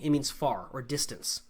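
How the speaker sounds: ordinary delivery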